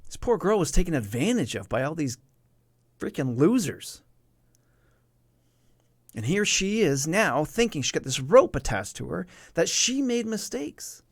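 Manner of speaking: worked up and incredulous; real indignation. Real, not acted. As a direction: protest the injustice, pitch climbing. Outraged at those who exploited her.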